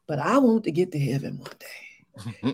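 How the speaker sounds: hushed, confiding whisper; playful whisper. Real, not acted. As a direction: lean in and whisper it. Playful confiding aside to host.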